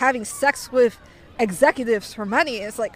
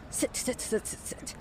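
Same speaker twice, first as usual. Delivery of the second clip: much softer, rapid-fire hushed repeats; mock urgent whisper; 12 dB quieter than usual. Seatmate saving a chair for her, mock urgent whisper. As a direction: imitate a hushed, insistent summons.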